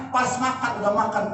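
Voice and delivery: usual speaking voice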